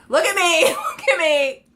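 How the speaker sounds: loud, high, laughing repeat of the phrase. Retold or imitated. Quoting herself, laughing.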